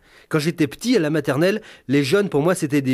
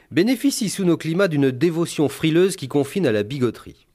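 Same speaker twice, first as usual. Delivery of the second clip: ordinary talk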